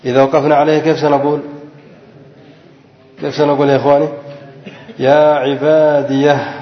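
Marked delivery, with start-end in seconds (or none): 5.0-6.5 "يا عبادية" slow, with a final h breathed out; وقف بهاء السكت. Shown as a demonstration. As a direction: stop on "عبادية" and let the hah be heard. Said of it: "بهاء السكت". (الوقف على "يا عبادية" بهاء السكت ببطء)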